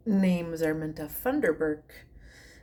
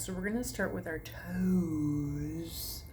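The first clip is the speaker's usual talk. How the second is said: guided meditation; 5 dB quieter than usual. Acted. To her online class, soothing meditation guidance. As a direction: talk them gently from toe to foot. Leads a toe-to-head relaxation.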